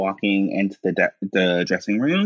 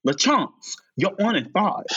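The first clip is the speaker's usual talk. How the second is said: lightly raised stage-manager call; 11 semitones higher than usual. Retold or imitated. Stage hostess calling LaChance to the stage, lightly pitched up. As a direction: cue her, a little higher. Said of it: "she says".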